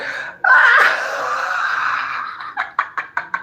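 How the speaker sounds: high breathy cackle; taunting laugh; mocking cackle. Real, not acted. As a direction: laugh in their face. Mocking the loser.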